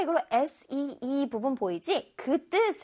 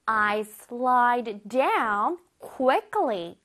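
slow example read; much slower than usual. Shown as a demonstration. Teacher reading example to children, very slow. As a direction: read "I slide down quickly" very slowly. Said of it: "예문에서는".

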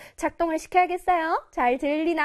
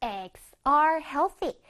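very slow, drawn-out reading; slow model reading; much slower than usual. Shown as a demonstration. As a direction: read it slowly for the class. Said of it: "그래서".

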